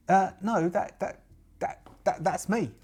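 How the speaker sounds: put-on high, stammering young woman; embarrassed young witch. Witch owning up to being the supposed hag, stammering high voice.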